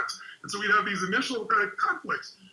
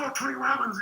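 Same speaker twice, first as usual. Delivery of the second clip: higher-pitched gushing recognition; excited store clerk; 8 semitones higher than usual. Retold or imitated. Store clerk greets a famous customer, excited. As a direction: imitate a thrilled clerk. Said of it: "the guy was all excited".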